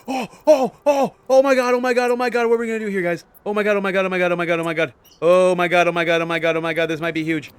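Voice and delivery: high, loud frantic oh my god; panicked excitement. Real, not acted. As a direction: panic over the game, then settle. Panicking at tense game moment.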